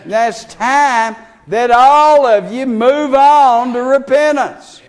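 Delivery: slow, loud, ringing preacher call; preacher calling everyone present to repent; altar-call preaching. Acted. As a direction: plead with them to come forward now.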